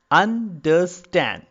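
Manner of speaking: "Understand" slowly, syllable by syllable, landing on "stand"; stress on third syllable. Shown as a demonstration. Lecturer making anapaest clear, slow "Understand" stressed on "stand". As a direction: split "Understand" in three slow beats, weight on the last. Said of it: "I'm giving more stress in the third part".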